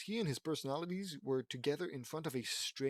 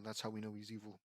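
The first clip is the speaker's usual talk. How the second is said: plain reading voice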